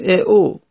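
ا او as two bare vowels, "e u"; consonant left out. Shown as a demonstration. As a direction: glide from "e" straight to "u" in ا او, no k between. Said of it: "مثل اینکه وجود نداره".

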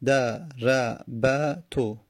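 دربتو stretched very slowly, each vowel held; teacher sounding out دربتو for counting; very slow demo. Shown as a demonstration. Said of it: "چهار حرف متحرک کنار هم اومدن".

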